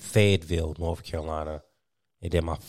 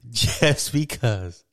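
laughing through quote; 5 semitones higher, much slower than usual. Retold or imitated. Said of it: "you said".

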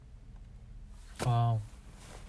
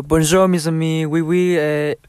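loud, put-on French accent; mock French; 3 semitones higher, 6 dB louder, slower than usual. Friend bluffing a French introduction of the group, mock French accent. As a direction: belt out clumsy French, slipping into Spanish. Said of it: "Introduce us in French".

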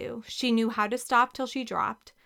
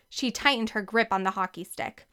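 usual speaking voice

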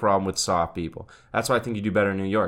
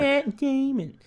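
high, very slow, dumb puppet voice; high, dragged-out Matt Damon puppet; Team America puppet; much slower than usual. Retold or imitated. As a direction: drawl it like a brainless puppet. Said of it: "Team America World Police".

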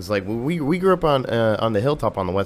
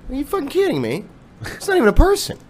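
much higher indignant disbelief; incredulous outrage; 3 dB louder than usual. Real, not acted. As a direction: protest in total disbelief. Outraged at being banned.

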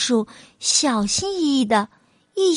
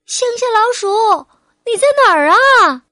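high, loud shout across the fields; calling out loudly; 11 semitones higher, 6 dB louder than usual. A narrator's reading. City mouse calling for country mouse across the fields, loud high shout. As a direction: call out to a friend far away. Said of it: "大声喊道".

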